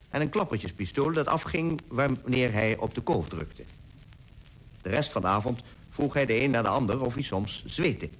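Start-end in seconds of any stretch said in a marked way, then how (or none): none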